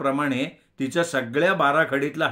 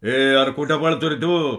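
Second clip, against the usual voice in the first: loud booming challenge; booming shout; 5 dB louder than usual. A narrator's reading. The burly cowherd booms at the fleeing narrator. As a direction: stop him with a big voice. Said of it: "दणकेबाज आवाजानं".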